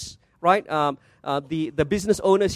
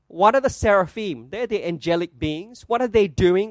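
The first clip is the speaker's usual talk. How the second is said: normal talking voice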